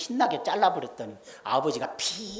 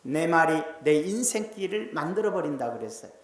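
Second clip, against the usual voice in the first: ordinary talk, nothing marked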